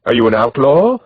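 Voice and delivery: haughty lady intoning from above; intoning noblewoman. A narrator's reading. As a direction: call it down from horseback like a royal. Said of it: "the second woman's voice intoned from above".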